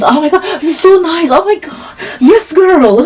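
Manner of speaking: high, delighted squeal; excited gush, pitched high. Retold or imitated. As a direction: rave about the lavish lights. Gushing about hotel bathrooms.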